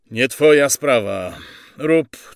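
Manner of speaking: loud, curt reprimand; forceful order rising high; officer's rebuke. Acted. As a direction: put the sergeant in his place.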